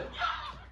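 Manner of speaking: high short burst of laughter; shocked laughter. Real, not acted. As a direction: yelp of laughter. Reacting to video.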